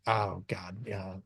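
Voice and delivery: low, very soft resigned mutter; reluctant sigh. Acted. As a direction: let it sink out quietly, reluctantly. [name] reluctantly answers repeated question.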